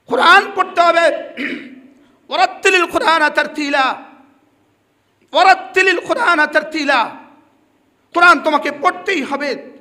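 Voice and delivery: loud, high preaching call; forceful proclaiming. Real, not acted. Preacher proclaiming to the congregation how the Quran must be read, loud. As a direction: declaim the verse with full force.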